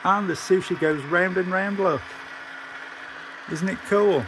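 high, loud and thrilled; excited. Real, not acted. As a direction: show it off with delight. Showing off working sushi toy.

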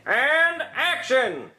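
slow drawn-out call, weight on and; director's call. Acted. Director starting the take, drawn-out call. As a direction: drag it out like a film director.

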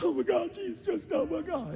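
very high, loud mock squeal; imitated gushing excitement. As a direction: squeal it like an overexcited onlooker. Imitating stunned people's excited reaction.

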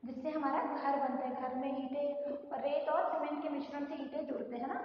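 ordinary delivery

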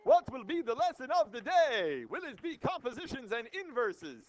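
loud, high game-show style opener; showy announcement. Pretend. Teacher hyping the lesson of the day to class, showy announcement. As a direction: belt out the question like a grand announcement.

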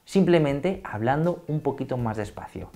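slow down and let each word land; calm, deliberate explainer pace; slow, clear speech. Shown as a demonstration. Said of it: "Has visto cómo cambia la manera de hablar".

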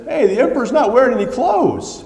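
put-on high, bright child calling out; a child blurting the truth; child's cry. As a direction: pipe it up like a little kid. Child calls out in story.

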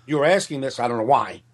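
usual speaking voice

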